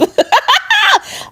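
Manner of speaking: high amused cackle; high laugh. Real, not acted. Laughing at her own comeback to the cheating husband. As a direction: burst out laughing.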